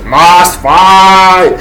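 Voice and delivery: a hint of a crazed zombie; infected growl. Infected man charging the group, lightly voiced crazed grunt. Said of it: "and he says".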